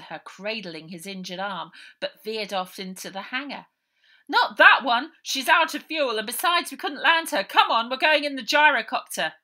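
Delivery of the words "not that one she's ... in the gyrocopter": higher, louder, hurried; urgent call. Acted. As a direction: call her back from the wrong plane. Harry calling out urgently.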